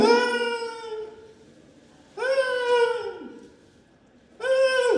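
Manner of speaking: high, long screams while falling. Acted. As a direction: scream as you drop through the sky. Little eagle falling from sky.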